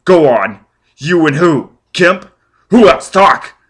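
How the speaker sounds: shouting at a dying man; furious demand. Acted. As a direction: shake the answer out of him. Foyle demanding answers.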